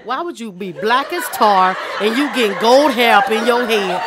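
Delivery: usual speaking voice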